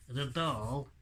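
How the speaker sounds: "doll" for ball, tongue touching teeth; "The doll" without lip closure; ventriloquist B sound. Shown as a demonstration. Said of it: "did you see what I did there".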